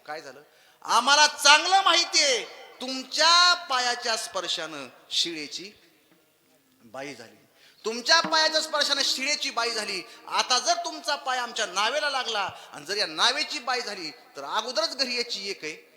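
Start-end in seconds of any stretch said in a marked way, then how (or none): 0.8-12.5 accusing shout. Acted. Mother accuses the god loudly.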